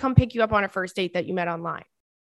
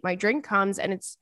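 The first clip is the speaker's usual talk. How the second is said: usual speaking voice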